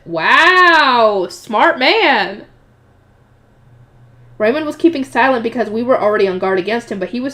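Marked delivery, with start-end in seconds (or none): 0.0-2.5 loud, drawn-out wow of admiration; impressed exclamation. Real, not acted. Reader admiring the clever researcher, impressed exclamation. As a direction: cheer him on, impressed.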